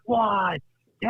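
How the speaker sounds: high, drawn-out count; mock crowd shouting the pinfall; imitated crowd count. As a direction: chant it out like an arena counting along. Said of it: "the whole place was".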